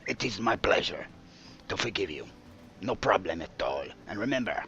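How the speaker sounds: lightly put-on French accent; gracious French-sounding gentleman. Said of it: "now you sound a little bit French".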